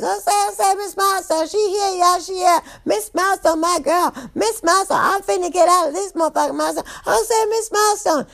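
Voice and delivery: high-pitched inmate clamor; everybody screaming. Acted. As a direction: squeal excitedly, as a crowd mobbing her. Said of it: "everybody's screaming and yelling".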